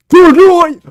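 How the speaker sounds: very loud, high mock hero shout; Batman punchline. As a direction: bellow it as the kick lands. Replaying the Batman skit.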